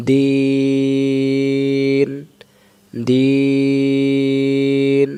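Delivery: দিন with the vowel held very long; three-alif stretch. Shown as a demonstration. Teacher demonstrating the long vowel in দিন. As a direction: draw out দিন over three beats. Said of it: "মনে মনে সাকিন হবে সেখানে".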